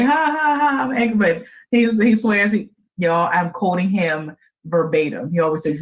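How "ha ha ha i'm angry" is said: laughing angry. Retold or imitated. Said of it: "the funny".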